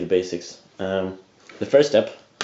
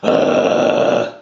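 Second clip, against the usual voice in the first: loud rasping sigh held out; raspy held sigh; 7 dB louder than usual. Shown as a demonstration. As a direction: hold the rasp in the sigh. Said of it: "you hold that raspiness".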